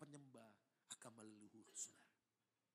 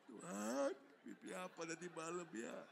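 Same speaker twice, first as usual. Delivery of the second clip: wailing lament; 3 semitones higher, 3 dB louder than usual. Acted. Laments to God after being attacked.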